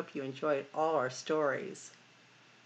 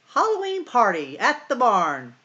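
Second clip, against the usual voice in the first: high, loud party announcement; excited note; 8 semitones higher, 10 dB louder than usual. Acted. The animals' note inviting Farmer Brown, read out excitedly.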